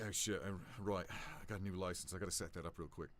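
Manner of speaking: low, soft muttering to himself; quiet NPC mutter with a sigh; muttered aside. Acted. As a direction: mumble it under your breath, distracted.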